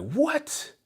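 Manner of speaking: a high, startled squeak; Chippy hearing the news, shocked; shocked what. Retold or imitated. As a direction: squeal it in total disbelief. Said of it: "And she's like".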